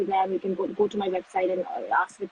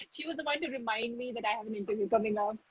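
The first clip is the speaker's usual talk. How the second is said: ordinary delivery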